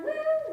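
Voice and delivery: high loud honk on Will; honking stutter. Acted. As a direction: stammer like a goose. Goose stutter.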